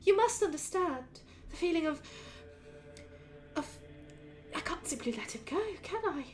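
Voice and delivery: lightly voiced fretful elf mother; elf mother pleading. Elf mother pleading with the player about her son. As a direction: wring your hands over an impossible choice. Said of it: "she looks at you and says".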